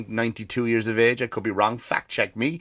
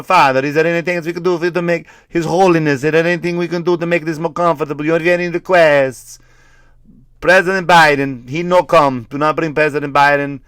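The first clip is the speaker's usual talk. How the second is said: put-on loud, higher-pitched hammy Italian accent; 5 semitones higher, 6 dB louder than usual. Attendant and dying Pope at the deathbed, Italian accent. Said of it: "he's Italian in this".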